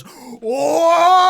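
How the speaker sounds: high, loud crowd cheer; crowd whoa. Acted. A tavern crowd cheering the Kraken tale, high and loud. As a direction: whoop like a whole tavern. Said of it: "and the room goes".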